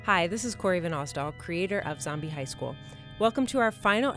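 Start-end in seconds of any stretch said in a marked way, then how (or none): none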